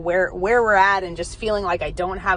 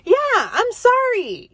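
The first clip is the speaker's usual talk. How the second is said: much higher, sheepish mock apology. Mom apologising to son for farting, sheepish high voice. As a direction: squeak out a guilty sorry. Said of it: "And I'm like".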